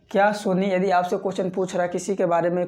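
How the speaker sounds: ordinary delivery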